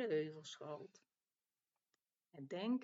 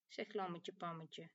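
usual speaking voice

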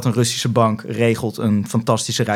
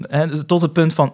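normal talking voice

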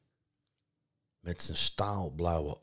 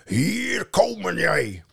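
loud, slow troll roar; 12 semitones higher, 8 dB louder, slower than usual. A narrator's reading. Troll pounding the boathouse, roaring. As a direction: bellow at the boathouse. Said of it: "stond brullend".

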